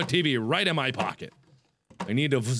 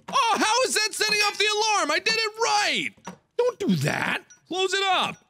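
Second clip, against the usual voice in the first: exasperated yelling. Real, not acted. Alarm going off again.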